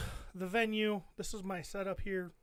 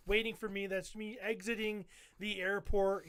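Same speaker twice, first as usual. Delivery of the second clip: normal talking voice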